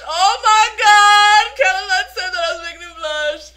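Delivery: loud, high, drawn-out elation; fangirl squeal. Real, not acted. Fan retelling the star's blush remark, loud ecstatic squeal. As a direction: cry out in overjoyed disbelief.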